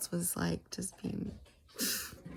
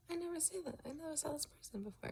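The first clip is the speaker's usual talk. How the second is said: puzzled quote; 9 semitones higher, 7 dB quieter, faster than usual. Retold or imitated. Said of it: "And you were like".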